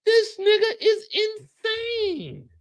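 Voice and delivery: high, drawn-out outraged squeal; exasperated shriek. Acted. Comic ranting about his unhinged neighbor Lou, high exasperated squeal. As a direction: shriek it in disbelief.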